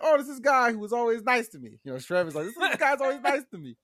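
high, loud pleading; whiny Trevor. Retold or imitated. Trevor vouches for the man, loud and high.

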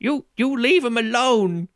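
put-on slow, high, loud old man's challenge; defiant old man. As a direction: play an old man squaring up to thugs. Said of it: "He's an old man who was".

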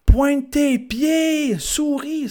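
very high, drawn-out shrill parody of a sideline dad; mock a pushy father screaming from the stands; mock yelling parent. Father yelling at his synchro swimmer daughter, parody high yell.

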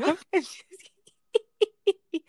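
laughing through the words; can't hold back a laugh while telling. Real, not acted. Laughing while telling her friend about the video.

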